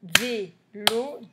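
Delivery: brief gap between the syllables of Vélo. Shown as a demonstration. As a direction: tap out VÉ, then LO, separately. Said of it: "Deux syllabes".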